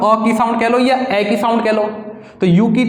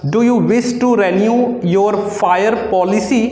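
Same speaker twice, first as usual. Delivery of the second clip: slow dictation; much slower than usual. Shown as a demonstration. Dictating shorthand exercise.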